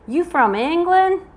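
put-on Southern accent. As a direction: play a nosy Southerner, drawling loudly. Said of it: "you will hear my Southern accent".

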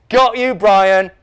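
high, loud, drawn-out teasing; gleeful taunt. A narrator's reading. Dave teasing his brother Brian, gleeful. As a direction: crow it slowly at your brother. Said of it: "laughed Dave".

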